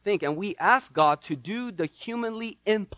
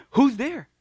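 higher, curious call; serving girl calls out to a knocker; Rhoda at the door; 8 semitones higher, 3 dB louder than usual. Acted. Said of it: "Rhoda, a young serving girl".

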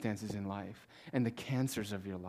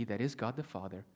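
ordinary delivery

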